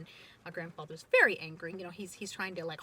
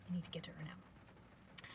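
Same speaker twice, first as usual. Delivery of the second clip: very soft, urgent-message whisper; whispered warning; 3 semitones lower, 14 dB quieter than usual. Retold or imitated. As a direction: repeat the instruction in a hush. Boy's warning repeated in a whisper.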